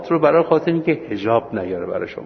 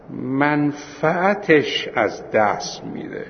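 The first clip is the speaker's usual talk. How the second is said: very slow, measured lecture dictation; 3 semitones higher, much slower than usual. Acted. Teacher dictating the third case to students, very slow. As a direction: spell the ruling out word by word for note-taking.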